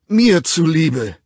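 slow, drawn-out loud plea. A narrator's reading. Bernard begging John slowly at full volume. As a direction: drag out the begging. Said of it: "flehte Bernard lautstark".

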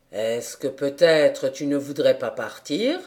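low voice with a teasing smile; smiling gentleman. A narrator's reading. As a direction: gently tease the child. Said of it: "en souriant". (voix grave et souriante du monsieur)

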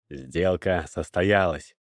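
solemn, slow, low declaration. A narrator's reading. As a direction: announce it solemnly, drawn out and low. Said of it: "торжественно заявил покупатель".

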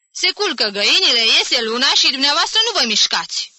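loud, high indignant protest; scandalized. A narrator's reading. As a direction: complain in outrage at the delay. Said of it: "se scandaliză Jim".

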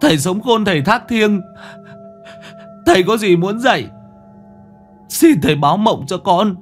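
high, wailing crying plea; weeping. A narrator's reading. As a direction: sob out a plea to the dead. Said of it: "ôm mặt khóc".

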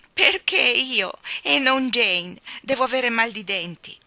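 put-on slow, loud childish whine; whining. Boy with toothache whining to his nanny. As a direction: drag the words out like a sulking boy. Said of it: "piagnucolò Michael".

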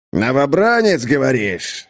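menacing, drawn-out captain's voice; sinister drawl. Acted. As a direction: draw it out ominously. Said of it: "зловеще протянул капитан". (зловещая протяжная интонация)

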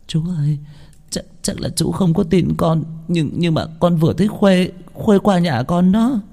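put-on a frightened girl sobbing her story. A narrator's reading. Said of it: "vừa nức nở". (vừa nói vừa nức nở)

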